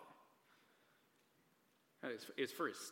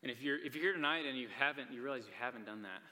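ordinary delivery

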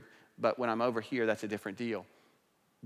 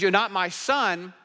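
louder, higher, slow "You're not my son"; harsh rejection; 6 semitones higher, 5 dB louder than usual. Retold or imitated. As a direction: hit every word like a father disowning his son.

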